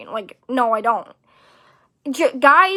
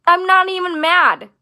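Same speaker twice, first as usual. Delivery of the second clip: higher-pitched, exasperated denial; indignant protest; 5 semitones higher, 3 dB louder than usual. Retold or imitated. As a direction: insist on it, pitch raised in protest. Protests being called angry by friend.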